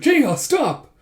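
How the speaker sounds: high, loud scolding cry; exasperated shout. Acted. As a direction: yell at a teasing friend to quit. Scolding a teasing friend.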